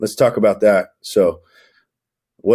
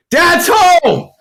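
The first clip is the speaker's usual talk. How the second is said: much higher, loud excited shout; excited dad greeting; 14 semitones higher, 4 dB louder than usual. Retold or imitated. Dad walking in the door to greet his family, excited shout. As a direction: burst in the door like it's a party.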